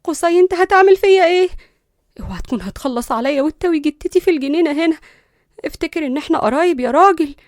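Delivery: high, loud frightened question; alarmed pleading. A narrator's reading. Girl carried into the garden by cousin, alarmed.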